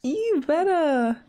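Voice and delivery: slow, stretched "You better"; "You better" said slowly, savoring it; drawn-out cheer. Real, not acted. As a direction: drag out "You better" admiringly. Cheering the sims on.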